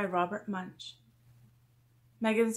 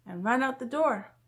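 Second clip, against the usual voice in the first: plain reading voice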